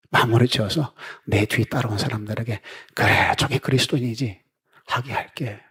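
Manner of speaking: soft, hushed, grateful farewell; tender farewell. Pretend. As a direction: speak it gently as a loving goodbye. Imagined farewell words from congregation. (조용하고 다정한 작별 인사)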